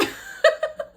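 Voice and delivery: high, loud burst of laughter; breaking out laughing. Retold or imitated. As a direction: re-enact a helpless laughing fit. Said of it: "I started breaking out laughing".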